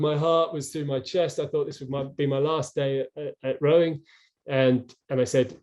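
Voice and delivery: ordinary delivery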